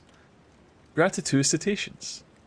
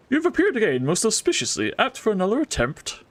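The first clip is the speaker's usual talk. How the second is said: lightly put-on character voice, higher; voiced NPC accent; 6 semitones higher than usual. Streamer voicing the NPC greeting, lightly accented. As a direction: greet the player like an odd merchant. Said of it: "This accent has changed".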